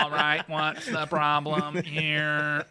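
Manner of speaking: slow, stretched, half-laughing robot supervisor; mock robot manager stepping in. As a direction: drawl it like a big security robot. Bigger robot comes out.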